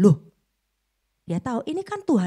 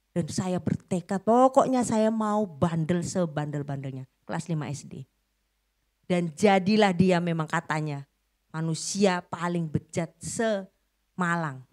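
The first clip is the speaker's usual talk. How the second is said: ordinary talk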